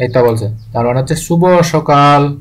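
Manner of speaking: ordinary talk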